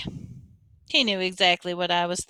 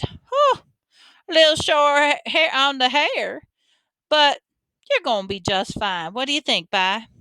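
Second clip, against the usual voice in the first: fussing Southern granny, pitched up then settling; Grandma Sands; 5 semitones higher than usual. A narrator's reading. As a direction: fuss over your grandson like an old granny.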